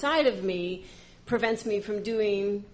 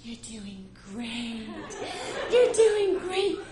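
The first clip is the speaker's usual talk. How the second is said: soothing coach; 5 semitones higher, slower than usual. Retold or imitated. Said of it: "birth coach is talking to me".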